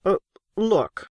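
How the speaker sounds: a nervous man catching attention; hesitant interruption. A narrator's reading.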